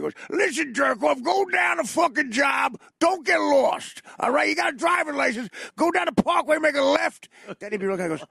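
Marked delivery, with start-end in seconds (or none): 0.3-7.3 uncle screaming. Retold or imitated. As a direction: bark the directions like an angry uncle. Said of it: "He'd be screaming at me".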